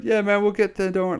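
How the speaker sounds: high, laid-back driver impression; chilled-out driver. Joint-smoking Uber driver reassures anxious passenger, high and carefree. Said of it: "just chilling out".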